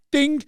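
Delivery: imitated ding. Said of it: "imitated bell".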